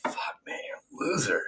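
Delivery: voiceless, strained whisper; exasperated whisper. Real, not acted. Fed up with his rival, in a strained whisper. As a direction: breathe it out in disgust.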